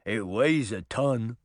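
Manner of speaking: slow, plodding tortoise voice. Acted. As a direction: drag it out like an old tortoise.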